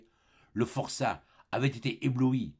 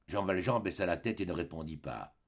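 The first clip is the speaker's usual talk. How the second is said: usual speaking voice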